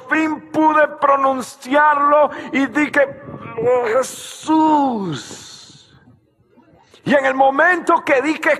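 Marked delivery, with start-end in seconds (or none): 0.1-6.0 straining to speak. Retold or imitated. Retells finally forcing out name.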